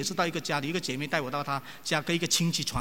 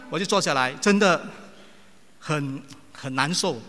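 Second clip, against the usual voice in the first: ordinary talk, nothing marked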